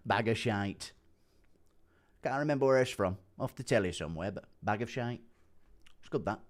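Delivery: soft, low-key mimicry of a voice message; Andy Aspinall impression. Streamer replaying fighter's message himself, soft impersonation. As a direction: quietly copy the fighter's offhand catchphrases. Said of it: "has heard my Andy Aspinall impression".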